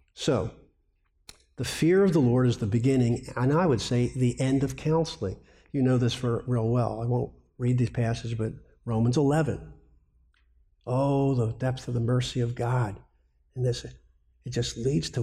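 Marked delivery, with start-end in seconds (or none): none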